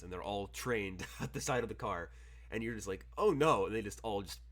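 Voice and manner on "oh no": sudden high, loud "oh no"; mock alarmed gasp. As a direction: blurt it out in alarm as bullets fly. Players shot at in limo.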